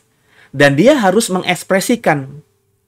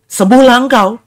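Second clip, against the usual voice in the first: commanding healing; 8 semitones higher, 5 dB louder than usual. Retold or imitated. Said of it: "Tapi bukan".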